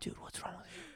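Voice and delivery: imitated whispered scolding; hushed whisper. Scolding noisy drunk friend in the quiet house, whispered. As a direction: whisper it, keeping the house asleep. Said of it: "trying not to wake my mom".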